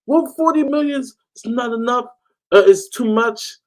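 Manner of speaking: high, whiny imitation of fans; mocking fans. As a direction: parody the fans' fickle complaints. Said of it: "some of the fans were like".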